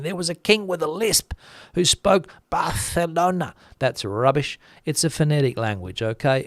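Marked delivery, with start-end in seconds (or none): 0.0-3.5 higher, insistent mock-commenter voice; mock protest in a raised pitch; mocking imitated commenters. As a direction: parody a know-it-all objection, pitched up, stretching the lisped "Barcelona". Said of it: "people go into the comment section saying".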